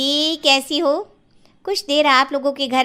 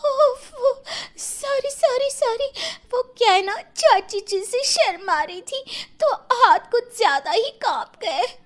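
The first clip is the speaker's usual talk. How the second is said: high, squeaky apologetic bride voice; flustered shy bride; 9 semitones higher than usual. Acted. Shy daughter-in-law apologising to the burnt guests, high and flustered. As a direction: babble sorry in a much higher voice. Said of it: "कांपते हुए हाथ".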